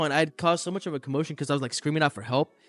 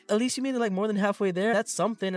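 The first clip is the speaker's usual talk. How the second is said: a hint of a sweet girl; 7 semitones higher, faster than usual. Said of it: "this girl, she was sweet".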